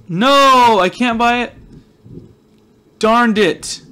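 a high, loud cry of dismay. Real, not acted. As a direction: let it burst out in disappointment. Can't buy the Deadpool banner.

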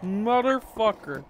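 ordinary delivery

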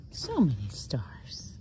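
slow hushed whisper. Real, not acted. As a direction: hush it in awe. Whispering to dog.

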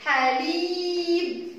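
long sung-out حليب; long vowel. Shown as a demonstration. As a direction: stretch the ya in حليب like a chant. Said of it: "صوت طويل".

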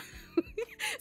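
a real high giggle. Real, not acted. Laughing at her own list obsession, high giggle. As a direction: break into giggles. Laughing at herself over lists.

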